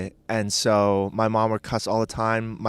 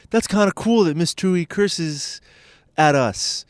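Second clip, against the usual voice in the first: higher-pitched imitation of kids; impressed kids; 7 semitones higher, 5 dB louder than usual. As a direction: lightly mimic impressed schoolkids, pitched up and louder. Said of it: "All the kids thought it was like".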